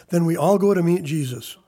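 loud retold last-straw answer; exasperated father. Father answering daughter after the sixth time, loud exasperation. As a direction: blurt it loudly, done with explaining.

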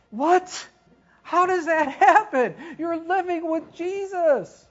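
high, loud disbelief breaking into a laugh; can't believe anyone would fall for it; incredulous laughing surprise. Real, not acted. Preacher to congregation, astonished laughing disbelief.

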